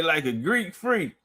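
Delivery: ordinary talk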